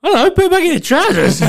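high, loud exasperated shout; telling the bleeding boyfriend off with a high loud shout; exasperated yell. Retold or imitated. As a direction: fling it back at him in disbelief. Her own retort in the retelling.

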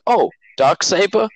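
high-pitched clueless fan question; mock shocked. Podcaster mimicking fans who only watch Mando, mock shocked. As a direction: imitate a casual viewer caught by surprise. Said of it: "like, shocked".

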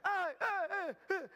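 high-pitched mock shrieks; play-acted screaming on a roller coaster. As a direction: yelp like a rider on the climb. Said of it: "cada vez que sube usted grita".

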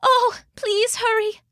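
very high, feeble invalid voice; ailing goddess in a frail high voice; sickly, coughing. A narrator's reading. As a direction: plead weakly as if ill with flu. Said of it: "Sif coughed".

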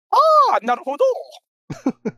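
loud, slow drawn-out "aha"; exaggerated realization. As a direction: play it as a dramatic revelation. Mock enlightened meme reaction.

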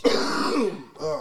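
clearing a rough throat mid-word; cough, then push the word out; coughing fit. Real, not acted. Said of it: "I'm coughing a lot".

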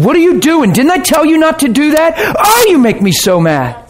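shrill up like a nagging parent; mock angry father. Preacher imitating a scolding father correcting a child, mock angry. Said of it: "He's not coming in and saying".

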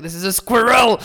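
put-on "squirrel" said high and loud as "skwer-el" in a French accent. [name] insisting to his American friends, in a loud, high French accent. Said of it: "I'm like".

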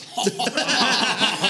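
big high-pitched laugh; sudden laughing outburst; burst of laughter. Real, not acted. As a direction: crack up at an absurd ending. Bursts out at the cancer ending.

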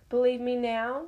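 wolf teasing the doubtful cat, lightly amused. A narrator's reading. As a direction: show off a little, amused. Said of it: "Smoke said, amused".

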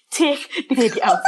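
half-laughing repeat of the plea. Retold or imitated. Mother retelling her plea to the midwife, laughing. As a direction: laugh through the begging. Retold begging midwife in labor.

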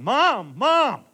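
loud, high yell for her mother; hollering. Retold or imitated. Daughter hollering for her mom by the car, loud and high. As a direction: call out to Mom at full volume. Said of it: "she started hollering".

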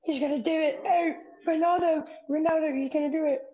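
high, fast, breathless football commentator calling a goal; mock commentator frenzy. Friend kicking ball, mocking an excited soccer commentator. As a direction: hype it up like a pundit screaming the goal.

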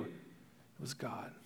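slow, whispered reassurance. Acted. Joseph reassuring his brothers, slow whisper. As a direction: let it land softly, slowly.

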